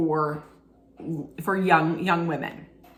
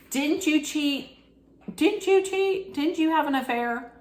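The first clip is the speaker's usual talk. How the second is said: higher, pointed mock interviewer; mock accusing interviewer; 8 semitones higher than usual. The interviewer confronting her about her affair, mock accusing. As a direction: needle her with the question. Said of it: "the interviewer was like".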